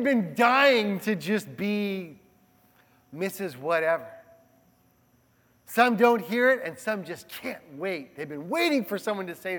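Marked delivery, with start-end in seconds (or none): none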